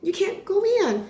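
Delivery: put-on high, slow, lightly accented sweet refusal; [name] with an accent. [name] refusing entry to the Golden Globes, sweetly, lightly accented. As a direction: let her down gently in a light put-on accent. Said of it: "had an accent".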